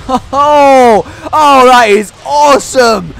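loud, high whoop of delight; real gleeful shout; thrilled outburst. Real, not acted. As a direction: crow with delight at the attack. Delighted by attack in game.

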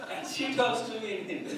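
amused, laughing delivery. Real, not acted. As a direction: still laughing at the joke. Joke about dreaming of Angelina Jolie.

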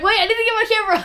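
high, fast, fussy imitation; mock frantic voice. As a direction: squeak it out in a hurry. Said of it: "You're like".

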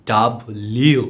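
"ডাব্লিউ" broken into two stretched beats; slow, syllable-by-syllable "ডাব্লিউ"; corrected letter W. Shown as a demonstration. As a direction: split "ডাব্লিউ" after the B, hold the ending. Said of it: "উচ্চারণ হবে কি".

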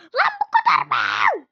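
loud annoyed yell; angry gamer outburst; frustrated shout. Real, not acted. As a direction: shout it at the game. Frustrated shout after fall.

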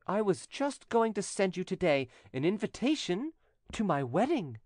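high-pitched, scornful announcement; haughty widow. A narrator's reading. Widow telling her jealous cousin of her wedding, haughty. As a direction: put him down, then announce it grandly.

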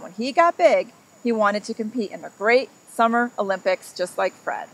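lively read-aloud storytelling voice; story narration. A narrator's reading. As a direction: read it like a picture book to a child. Reads picture book to children.

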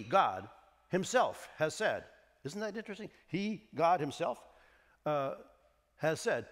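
normal talking voice

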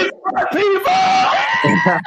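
high, slow, stretched-out shout; drawn-out excited cry. Real, not acted. Host welcoming guests, a high drawn-out shout. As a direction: draw it out up high with joy.

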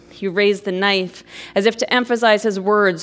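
ordinary delivery

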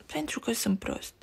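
very soft, ashamed half-whisper; almost whispered. Acted. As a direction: say it barely above a breath. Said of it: "spuse Leni aproape șoptit".

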